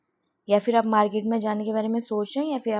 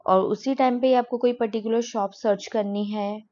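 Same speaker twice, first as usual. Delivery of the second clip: usual speaking voice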